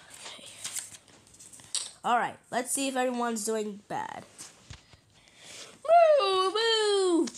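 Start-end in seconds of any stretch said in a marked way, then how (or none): 5.8-7.3 very high, loud playful moo; excited pretend cow; whooping cow moo. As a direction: let out a wild high moo. Cow chase game begins.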